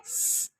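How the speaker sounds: स as a sustained hiss, "sss"; phonic sound of S. Shown as a demonstration. Teacher asking for the S sound, answers with a hissed स. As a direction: hold स as a voiceless "sss", no vowel. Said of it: "S की sound क्या है?".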